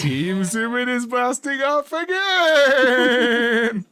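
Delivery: high, loud, slow blasting-off cry; cartoon villain exit. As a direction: wail it as you fly away. Parodying cartoon villains flying off.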